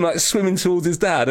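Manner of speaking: usual speaking voice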